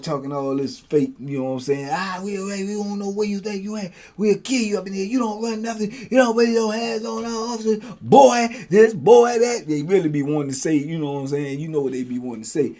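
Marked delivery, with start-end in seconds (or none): 1.9-9.7 higher, mocking officer threats; mock the guards' trash talk; taunting guards. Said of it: "running their mouth".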